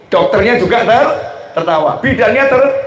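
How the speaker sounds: normal talking voice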